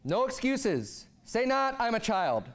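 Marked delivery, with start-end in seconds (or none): none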